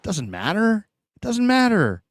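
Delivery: high, brushing-off voice; a losing fan waving off the preseason; mock dismissive fan. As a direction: shrug it off like a sore loser. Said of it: "you're like".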